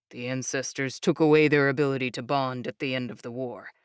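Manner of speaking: low, calm proud explainer voice; proud young man. Acted. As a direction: speak low and proudly steady. Jake explains.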